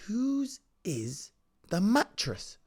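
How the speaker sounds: high, slow, drawn-out question; mock wondering. Child eyeing the big present, high mock wonder. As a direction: stretch it out in exaggerated suspense.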